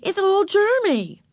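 high, slow, emphatic warning; bossy objection. A narrator's reading. Beezus warns Ramona off the sucker, bossily emphatic. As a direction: put a little sister off it.

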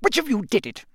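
high, snapped accusation; snapping. A narrator's reading. Crouch taut with rage at the children, snapping. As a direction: snap the question at them. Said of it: "he snapped".

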